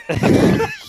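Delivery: high, loud burst of laughter; shrieking laugh. Real, not acted. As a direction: squeal with laughter.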